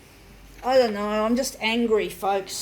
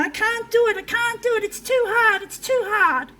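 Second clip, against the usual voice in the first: much higher-pitched, imitated child in despair; childhood can't-do-it outburst; 10 semitones higher, 3 dB louder than usual. Man re-enacting his childhood can't-do-it episodes, high childlike wail. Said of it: "like when I was a child".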